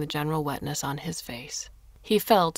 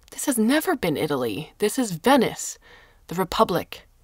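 louder and higher, hammering the point; angry insistence; 3 semitones higher, 6 dB louder than usual. A narrator's reading. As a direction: declare it like a proud Venetian. Carlo angrily lectures them.

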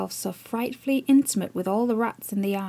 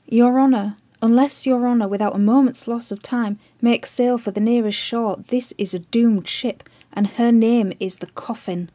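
louder, urgent pleading; desperate plea; 5 dB louder than usual. Acted. Chips on his knees begging the admiral, loud and urgent. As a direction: beg for the ship as if lives hang on it.

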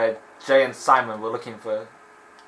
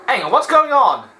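sudden high, loud "hang on"; real surprise, pitched up and louder; startled; 12 semitones higher, 9 dB louder than usual. Real, not acted. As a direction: caught off guard mid-game. Sudden confusion in the game.

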